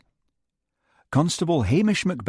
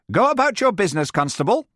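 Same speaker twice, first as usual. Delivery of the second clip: curt dismissal; 12 semitones higher, 4 dB louder, faster than usual. Acted. Lady Jane dismisses Hamish.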